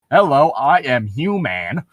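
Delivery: slow, lightly robotic insistence; mock stiff human. Edward pretending to be normal, slow and stiff. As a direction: say it like a bad fake human.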